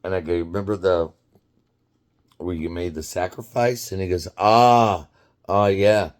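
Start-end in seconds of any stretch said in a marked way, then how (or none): none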